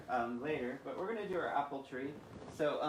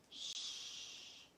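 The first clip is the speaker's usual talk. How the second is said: soft whispered shush; hushing shh; 8 dB quieter than usual. Acted. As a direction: hush them to sleep. Bedtime hush.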